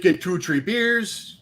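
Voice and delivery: slow Wisconsin drawl with "tree" for three; mock Wisconsin accent, unhurried. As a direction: lean into a thick Wisconsin accent, slowly. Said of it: "the Wisconsin version".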